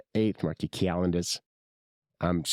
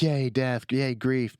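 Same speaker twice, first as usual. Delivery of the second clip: higher-pitched, half-hearted mock celebration; mock cheer; 5 semitones higher, slower than usual. Griever showing what they don't feel, mock cheer. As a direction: fake a little cheer, lifting the pitch.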